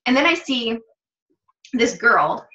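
ordinary talk, nothing marked

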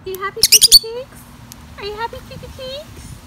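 high sing-song voice cooing to the birds. Real, not acted. Owner cooing at her parrots on their swing, high sing-song baby talk.

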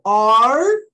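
"R" held loud and rising; long, loud retroflex "R"; English letter R. Shown as a demonstration. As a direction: stretch the curled sound at the end of "R". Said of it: "Olha o som do R".

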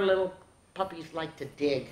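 ordinary delivery

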